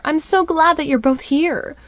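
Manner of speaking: high, loud and overjoyed; giddy. A narrator's reading. Stepmom, whirled around by dad, gushing loudly. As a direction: gush it out, spinning with glee.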